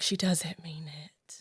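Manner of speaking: slow, hushed whisper; whispered aside. Retold or imitated. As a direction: whisper it slowly, like a secret aside. Retold hushed aside in a meeting.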